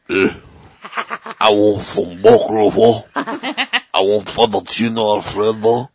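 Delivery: slow, cartoonish old Italian; bad Italian accent. Italian customer ordering at Fazoli's, slow hammed-up Italian accent. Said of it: "my favorite Italian voice to do".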